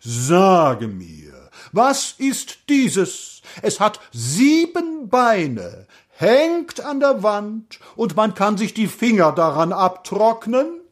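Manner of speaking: slow, high-pitched grand declamation; solemn. A narrator's reading. Road keeper posing the sphinx's riddle to the prince, solemn declamation. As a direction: intone the riddle like an oracle. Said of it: "sagte er feierlich".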